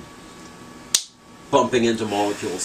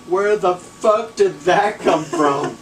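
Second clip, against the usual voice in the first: stunned disbelief; 8 semitones higher, 5 dB louder, much slower than usual. Retold or imitated. Amazed at his own accent.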